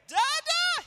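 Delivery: dad imitating his son calling from the crib; toddler shout. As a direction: call out like a baby waking at dawn. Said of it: "But five A M".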